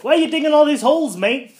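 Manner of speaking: mock British shout. Doom confronts Silver Surfer, loud mock British demand. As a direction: yell it like a cocky Brit.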